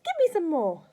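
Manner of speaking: high, loud crone demand; greedy demand. A narrator's reading. Grandma demands medicine.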